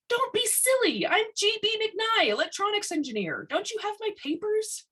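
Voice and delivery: high, indignant woman's voice. A narrator's reading. McKnight scolds the superintendent, high and indignant. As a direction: lift the voice high and bristle.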